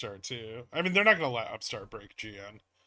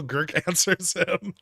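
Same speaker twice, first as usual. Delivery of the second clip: laughing; 4 semitones higher, 7 dB louder than usual. Real, not acted. Laughing at chat.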